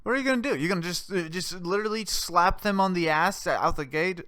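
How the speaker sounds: ordinary delivery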